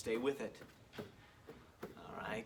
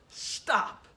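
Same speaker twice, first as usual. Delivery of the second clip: shouted stop; 9 semitones higher, 7 dB louder than usual. Real, not acted. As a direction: Stop called high and loud. Calling time to end drill.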